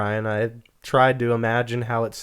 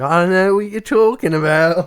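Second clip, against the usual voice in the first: higher, louder put-on Aussie; mock Australian accent; 7 semitones higher, 7 dB louder than usual. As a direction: play an oblivious Australian. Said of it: "you'd be like".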